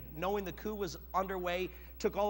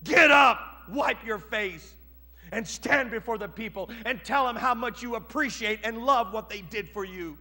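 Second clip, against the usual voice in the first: commanding shout; 4 semitones higher, 11 dB louder than usual. Acted. Joab orders David up.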